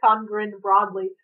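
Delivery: ordinary delivery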